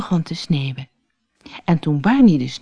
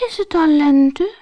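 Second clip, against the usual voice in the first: sleepy; 11 semitones higher, 3 dB louder than usual. Acted. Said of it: "vroeg de eekhoorn slaperig".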